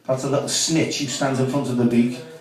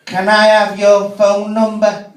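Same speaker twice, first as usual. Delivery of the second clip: slow flirty woman; 6 semitones higher, 4 dB louder, slower than usual. Retold or imitated. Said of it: "She said".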